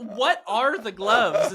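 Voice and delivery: put-on high, slow kid's cry through laughter; amused mock-child wail; imitated child's demand. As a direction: draw it out like an insistent child. Quotes child's demand again, laughing.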